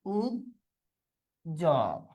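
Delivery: Ujjwal low and soft, as "uj ... jol"; long pause inside Ujjwal; syllable by syllable. Shown as a demonstration. Teacher dictating to students, syllable by syllable.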